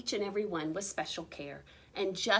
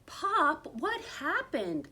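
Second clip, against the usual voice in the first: put-on child crying out; 6 semitones higher, slower than usual. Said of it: "cried Junior".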